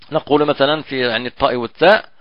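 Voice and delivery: ordinary talk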